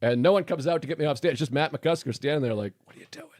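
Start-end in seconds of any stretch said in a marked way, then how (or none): 2.8-3.3 very soft, barely voiced aside; quietly imitated question; hushed mock question. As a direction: imitate a colleague asking under the breath. Imitating colleague waiting by stage.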